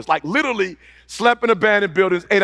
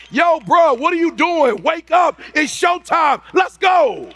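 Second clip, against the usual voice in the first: fired-up shout; 7 semitones higher than usual. Retold or imitated. Preacher to his younger self, shouting him awake. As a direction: yell yourself awake in the mirror.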